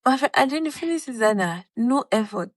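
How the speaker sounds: normal talking voice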